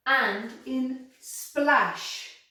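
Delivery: And in splash drawn out very slowly; slow example. Shown as a demonstration. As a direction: stretch splash for the sh. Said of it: "teacher stretches example word".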